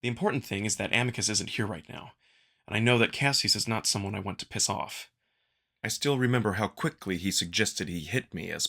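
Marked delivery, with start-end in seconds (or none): none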